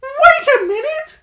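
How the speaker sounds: as if the penny just dropped; dawning realisation. A narrator's reading. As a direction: badger suddenly getting the idea. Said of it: "his eyes widened".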